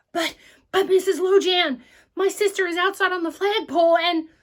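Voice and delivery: high, loud stammering plea; desperate boy pleading with librarian; urgent pleading. Acted. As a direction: blurt it out in a panic.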